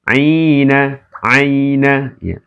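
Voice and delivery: "aina aina" read slowly with a short ai; slow recitation drill. Shown as a demonstration. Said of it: "Kemudian ini ya".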